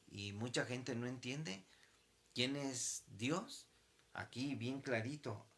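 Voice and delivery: normal talking voice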